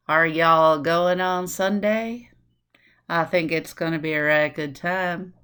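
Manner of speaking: slow Southern Alabama accent; Alabama drawl. Shown as a demonstration. Teacher contrasting Alabama speech, slow Southern accent. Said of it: "Now go to Alabama".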